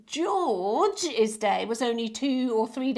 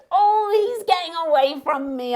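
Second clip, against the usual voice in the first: higher and louder mock dismay; playful alarm; 6 semitones higher, 7 dB louder than usual. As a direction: pretend the bee escapes you. Playful chase of the bee.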